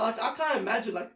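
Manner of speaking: ordinary talk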